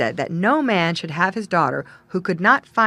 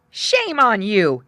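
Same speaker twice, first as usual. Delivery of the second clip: slow, loud reproach; scolding cry; 5 dB louder, slower than usual. A narrator's reading. Parson scolding the girls running after the young man. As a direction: scold them sharply. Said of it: "he cried out".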